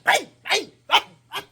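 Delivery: high, loud punchy bangs; exclaimed knockout sound effects; spoken impact noises. Retold or imitated. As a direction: fire off each bang like a blow. Acting out breath knocking them out.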